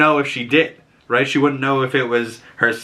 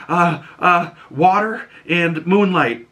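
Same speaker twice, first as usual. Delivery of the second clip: high, slow, dazed reply; halting mock answer; 6 semitones higher, slower than usual. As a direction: stammer out the answer slowly.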